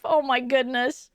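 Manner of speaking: ordinary delivery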